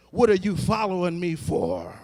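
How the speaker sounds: ordinary talk, nothing marked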